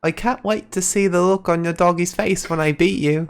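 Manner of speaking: high, put-on Welsh accent; Tegan Knox's Welsh accent. Co-host quoting Tegan Knox's promo line, in her Welsh accent. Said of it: "that's actually really close".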